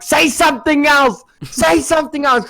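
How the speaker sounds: high, strained mock screaming; retold scream. Screaming at the friend who keeps punching him, mock furious. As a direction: scream the demand, in play. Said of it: "I scream at him".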